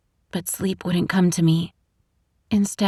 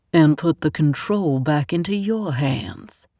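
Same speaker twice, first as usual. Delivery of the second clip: Southern man; 3 semitones lower than usual. A narrator's reading. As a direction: push back, doubtful, Southern accent. The man finishes her thought.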